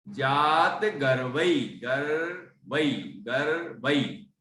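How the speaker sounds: गर्वई said slowly, syllable by syllable; teacher slowly sounding out गर्वई for the class; careful pronunciation. Shown as a demonstration. गर्वई का धीमा, साफ़ उच्चारण. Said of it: "तो गर्वई ऐसा पढ़ा जाएगा".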